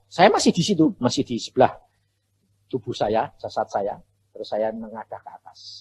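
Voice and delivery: ordinary talk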